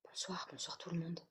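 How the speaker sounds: hushed whispered welcome; whispering. Real, not acted. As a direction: greet them in a whisper. Whispered greeting to newcomers.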